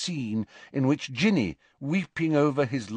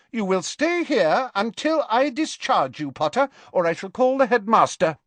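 high, loud stern nurse; stern threatening matron; 11 semitones higher, 4 dB louder than usual. Acted. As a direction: threaten the boy with authority. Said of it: "in a threatening manner".

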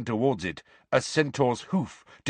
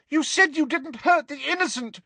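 high-pitched desperate protest; Hermione, tearful shout. Acted. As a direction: cry out desperately, close to tears. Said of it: "shouted Hermione".

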